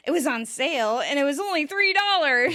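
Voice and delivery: bargain brag. Retold or imitated. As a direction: proudly show off a bargain. Host supplies missing excited boast.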